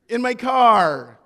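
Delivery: loud slow shocked emphasis; alarmed outburst. Retold or imitated. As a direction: stretch it out in disbelief, loud. Realizing strangers in his car.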